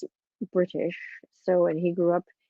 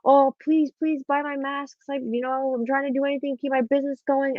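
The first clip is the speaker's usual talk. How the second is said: mock pleading seller; 7 semitones higher than usual. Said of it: "being like".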